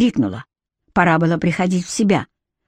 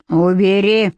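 приказным тоном; slower than usual. A narrator's reading. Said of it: "приказал тип за моей спиной".

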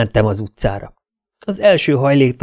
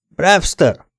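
higher mild call; 8 semitones higher, 3 dB louder than usual. A narrator's reading. Narrator's hero summons his henchman into the office, calling out. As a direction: call a name out, not at full volume. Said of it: "kiáltottam".

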